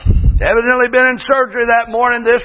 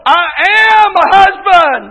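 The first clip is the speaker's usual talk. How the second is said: high, loud mock proclamation; pompous husband; 6 semitones higher, 5 dB louder than usual. Preacher mimicking husbands who lord it over, pompous and loud. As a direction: declare the title with puffed-up self-importance. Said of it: "too many people want to go".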